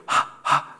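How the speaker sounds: soft voiceless puffs, "hah, hah"; breathy English H. Shown as a demonstration. Teacher showing the English H, puffs like cleaning glasses. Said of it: "limpiar las gafas".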